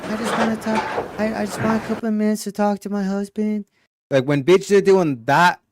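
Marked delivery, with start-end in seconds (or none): 0.1-3.6 high, sweet mock baby voice; mocking a woman's sugary little-girl voice; cutesy baby voice. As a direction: imitate a woman going cutesy to sound innocent. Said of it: "she's doing all the whole cutesy".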